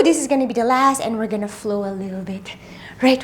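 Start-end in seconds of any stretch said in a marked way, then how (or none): 1.0-2.6 whispering. Real, not acted. Breathless aside mid workout.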